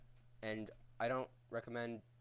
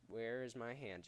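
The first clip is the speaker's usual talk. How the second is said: ordinary talk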